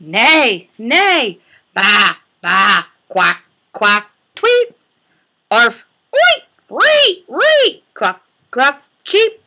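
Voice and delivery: bark, bleat and tweet each animal in turn; animal sounds. Acted. Teacher voicing farm animals for toddlers.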